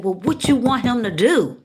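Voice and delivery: high, pressing question; insistent questioning. Retold or imitated. Spirit again asking what she wants, insistent questioning. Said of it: "again, my spirit said".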